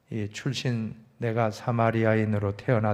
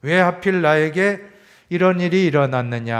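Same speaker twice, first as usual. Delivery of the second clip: imitated complaint; 4 semitones higher, 6 dB louder than usual. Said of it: "하면서 원망하고 불평하였을".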